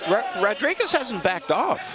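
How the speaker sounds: high excited call, Rodriguez hasn't backed off. Real, not acted. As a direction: shout it out as the fight heats up.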